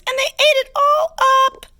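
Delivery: sputtering little bear's squeaky complaint; high squeaky baby bear. A narrator's reading. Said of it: "in her high squeaky voice".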